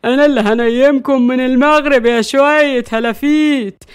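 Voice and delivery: loud, domineering threat; loud boast of being in charge; bossy grandmother. Acted. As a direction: lay down the law, loudly.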